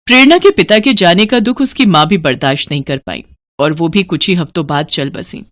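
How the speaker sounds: usual speaking voice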